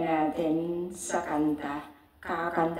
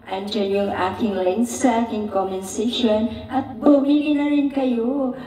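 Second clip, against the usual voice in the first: excited; 4 semitones higher, 7 dB louder than usual. Real, not acted. Urging viewers to buy.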